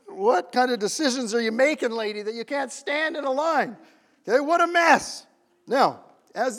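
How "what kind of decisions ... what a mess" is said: scolding, acted-out exasperation; mock tirade at a stranger; indignant inner rant. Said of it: "This is what's going on in my head".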